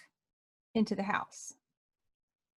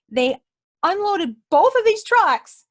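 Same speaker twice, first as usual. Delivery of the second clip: amazed emphasis; 6 semitones higher, 9 dB louder, slower than usual. Real, not acted. Amazed at the huge delivery.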